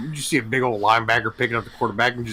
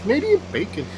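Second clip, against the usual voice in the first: Canadian correcting ham to Canadian bacon; mock Canadian; 4 semitones higher than usual. Mocking Canadian food talk.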